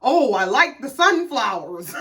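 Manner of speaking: slow, drawn-out mimicry of a husband; Greg impression. Husband approving the brunch decorations, imitated slowly. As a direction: imitate him slowly, trying to sound agreeable. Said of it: "he sounds exactly like".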